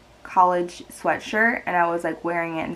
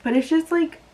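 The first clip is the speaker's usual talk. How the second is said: tearful, strained voice, a little higher; crying over the breakup; real crying; 5 semitones higher, 3 dB quieter than usual. Real, not acted. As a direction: push the words out through tears. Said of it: "why am I crying about this".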